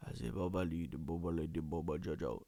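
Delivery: low, soft babbling; mumbled low nonsense syllables; talking gibberish. Retold or imitated. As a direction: imitate a delirious man babbling. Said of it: "starts talking gibberish".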